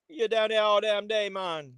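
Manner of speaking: put-on a deliberately terrible Jamaican accent for laughs; bad Jamaican accent. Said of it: "the worst Jamaican accent ever".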